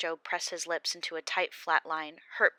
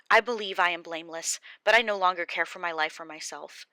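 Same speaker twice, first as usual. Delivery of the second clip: louder, anguished protest; despairing Job; 2 semitones higher, 7 dB louder than usual. Acted. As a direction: cry out against his fate.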